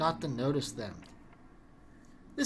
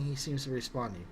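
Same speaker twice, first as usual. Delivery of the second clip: ordinary talk, nothing marked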